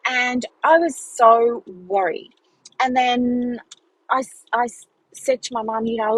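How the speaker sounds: ordinary talk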